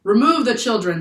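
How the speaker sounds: a loud order; roaring. A narrator's reading. Captain roars at crew to remove the children, loud. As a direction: roar it at the crew. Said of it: "the captain roared".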